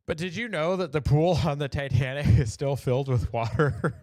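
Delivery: trying to get the joke out while cracking up; laughing through it. Real, not acted. Reading the dark meme to viewers, laughing. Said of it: "I can't even say it without laughing".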